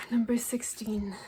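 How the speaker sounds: hushed whisper. Real, not acted. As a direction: read the number under your breath. Reading card number to herself.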